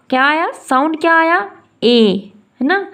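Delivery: ordinary delivery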